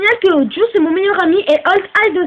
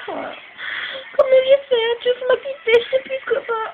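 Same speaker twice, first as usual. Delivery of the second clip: tearful lament; 7 semitones higher than usual. Acted. Heartbroken girl laments lost love.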